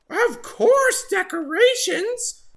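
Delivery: very high, slow chirpy bird; chirped. Acted. Oxpecker cheerfully decorating Water Buffalo's horns, chirping. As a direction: chirp it brightly. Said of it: "chirped Oxpecker".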